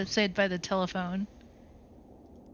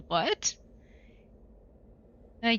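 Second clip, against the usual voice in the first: ordinary talk, nothing marked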